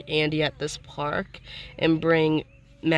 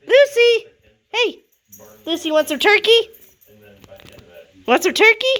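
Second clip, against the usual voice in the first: high excited call to a dog; 6 dB louder than usual. Real, not acted. Calling the dog.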